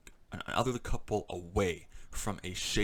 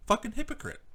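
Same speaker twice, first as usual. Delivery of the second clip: much higher, spat-out disgust; exasperated insult; 14 semitones higher, faster than usual. Real, not acted. Vlogger calling the rude man a hypocrite, exasperated. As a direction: fling the word up high in disbelief.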